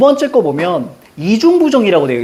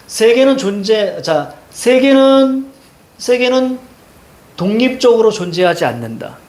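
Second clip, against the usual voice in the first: slow, raised pitch with long held syllables; drawn-out recitation; 4 semitones higher, slower than usual. Real, not acted. Teacher drilling the anti-realist claim, slow drawn-out recitation. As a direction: stretch the subject out like a chant.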